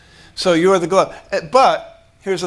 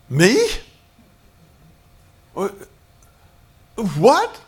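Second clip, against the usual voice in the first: startled disbelief; 4 semitones higher, 4 dB louder, slower than usual. Retold or imitated. Moses startled by God's command.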